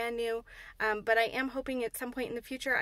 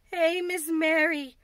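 slow, high, louder wail of distress; frightened maid begging Miss Mary, half crying; 6 semitones higher, 8 dB louder, slower than usual. Acted. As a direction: near tears, pleading. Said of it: "she said, half crying".

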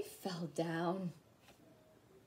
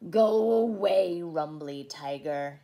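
huffy; 3 semitones lower, 8 dB louder than usual. A narrator's reading. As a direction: huff it out, big on "go away". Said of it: "he huffed".

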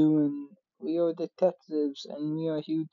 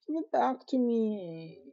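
higher and slow, pleading; whiny high plea; 7 semitones higher than usual. Acted. Begging the thief for the chocolate, high and slow. Repeats demand more insistently.

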